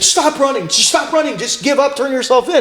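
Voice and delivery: high-pitched, insistent retelling; imitated urgent pleading. Boss begging fleeing driver to surrender, imitated high urgent plea.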